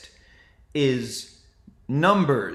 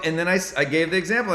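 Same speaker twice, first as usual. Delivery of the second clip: ordinary talk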